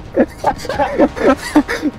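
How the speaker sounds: high, soft wheezing laugh; real laughing fit; helpless laughter. Real, not acted. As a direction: laugh in long squeaky bursts. Laughing at crying child.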